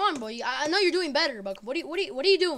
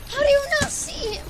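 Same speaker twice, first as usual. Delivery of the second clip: high, louder exasperated demand; angry yell; 9 semitones higher, 5 dB louder than usual. Real, not acted. Scolding teammate.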